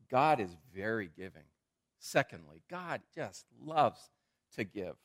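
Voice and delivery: slowed, savoring the phrase. Real, not acted. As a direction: lean into each word. Slowed emphatic sermon point.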